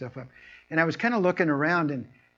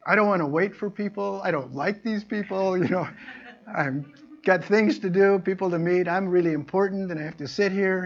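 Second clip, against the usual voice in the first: mock impatient whine; 5 semitones higher, 4 dB louder than usual. Mocking impatient self-important person.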